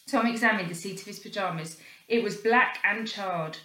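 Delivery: plain reading voice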